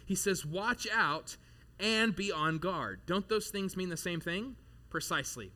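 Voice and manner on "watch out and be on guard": slow, weighty "Watch out" then "and be on guard"; double emphasis on watch out and on guard. Shown as a demonstration. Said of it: "Notice the double emphasis here".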